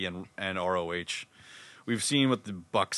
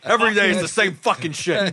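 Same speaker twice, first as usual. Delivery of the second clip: put-on grumpy New York accent, higher and louder; 8 semitones higher, 5 dB louder than usual. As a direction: grumble it like a cranky New Yorker. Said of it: "just doing the New York accent".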